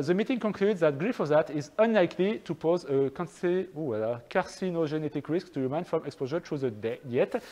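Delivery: careful, halting French-accented English. Real, not acted. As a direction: stumble through English in a thick French accent. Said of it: "avec mon superbe accent".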